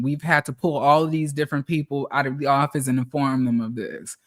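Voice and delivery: hammed-up corporate sympathy; mock apologetic boss. Mocking the boss apologizing for the layoff, soft and fake-sorry. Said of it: "these bitches tell me".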